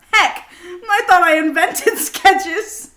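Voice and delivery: giggling through the words; laughing. Real, not acted. Girl reading her childhood journal, laughing at herself.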